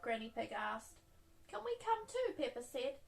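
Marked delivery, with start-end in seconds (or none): 1.5-2.3 put-on high, eager child voice; excited child. A narrator's reading. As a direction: ask eagerly like a little girl.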